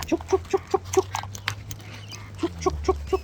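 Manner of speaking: high, breathy chicken clucks; clucking call. Retold or imitated.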